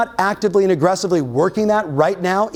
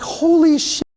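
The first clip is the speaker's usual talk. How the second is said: shocked reaction; 11 semitones higher than usual. Retold or imitated. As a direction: blurt it out in disbelief. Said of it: "I was like".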